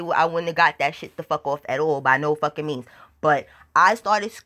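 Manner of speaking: usual speaking voice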